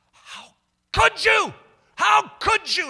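high, loud, drawn-out scolding cry; appalled, accusing outcry; indignant rebuke. Retold or imitated. Aide confronting the kids who ran off, indignant.

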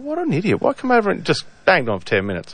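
retelling an incredulous snap at the bloke; exasperated outburst. Retold or imitated. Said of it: "I'm like".

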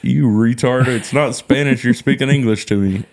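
acted-out indignant correction; imitated Spanish speaker's retort. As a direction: scold back as the offended listener. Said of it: "You're like".

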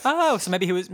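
ordinary talk, nothing marked

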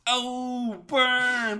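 high, loud drawn-out jeer; whooped, stretched-out taunt; mock gloating. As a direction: crow it like a playground burn. Jeering at the game makers.

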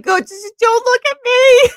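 high, loud hammed-up wail; mock tearful plea. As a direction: shoo him away in embarrassed mock sobs. Re-enacts crying, embarrassed plea to husband.

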